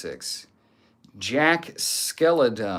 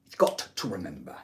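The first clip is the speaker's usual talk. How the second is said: Andrew Tate impression; 5 semitones lower, faster than usual. Streamer retrying the requested speech, lower Tate accent impression. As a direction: lower attempt at Tate's accent. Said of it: "the accent correctly".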